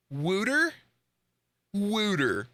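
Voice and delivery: "Wooter" with a high oo vowel, questioning then stated; Philly-style "Wooter" for water; mocked pronunciation. Shown as a demonstration. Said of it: "this is the word".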